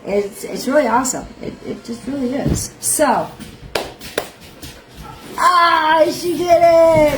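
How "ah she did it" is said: loud, high, drawn-out squeal; delighted cheer. Real, not acted. Host cheering her sister coming on camera, delighted cheer. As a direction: cheer it high and long, breaking into laughter.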